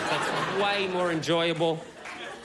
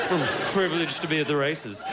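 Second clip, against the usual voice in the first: put-on posh old man; 4 semitones lower than usual. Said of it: "posh old men who are so upper class".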